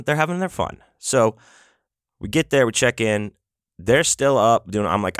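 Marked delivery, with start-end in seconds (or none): none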